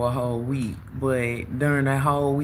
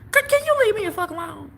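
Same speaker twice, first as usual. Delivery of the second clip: much higher, louder fed-up yell; exasperated yell; 4 dB louder, faster than usual. Retold or imitated. Yelling at the pestering guy to leave her alone, high exasperated screech. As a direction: screech it like you've had enough. Fed up with his constant calls.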